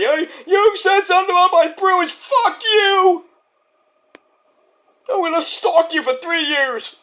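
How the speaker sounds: mock an unhinged fan threatening you; over-the-top furious sports fan voice; angry fan. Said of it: "they take things so seriously".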